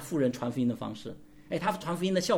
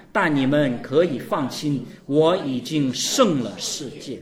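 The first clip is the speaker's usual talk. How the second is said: loud, slow recitation of the verse; unison scripture reading; 8 dB louder, slower than usual. Acted. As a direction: read it out together, slow and full-voiced. Congregation reads scripture together.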